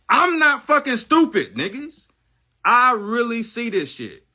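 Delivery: sudden loud, high shout; yelling at the viewers in disbelief; angry outburst. Real, not acted. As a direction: blast it out in frustration. Angry outburst in live rant.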